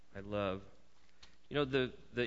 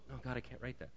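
soft, protesting Moses, much quieter; mock-humble Moses shying away, hushed; reluctant Moses; 11 dB quieter, faster than usual. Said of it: "You can just see Moses going".

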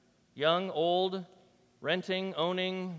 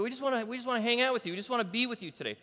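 higher, rushed, eager pleading; eager disciples; 7 semitones higher, faster than usual. Retold or imitated. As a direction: blurt it out fast like keen followers tagging along. Voices eager disciples addressing Jesus.

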